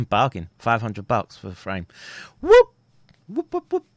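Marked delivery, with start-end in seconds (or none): 2.4-3.9 high, loud whoops of joy; celebratory whoop. Real, not acted. Vlogger celebrating his purchase to viewers, whooping high and loud. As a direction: whoop it up like you just won.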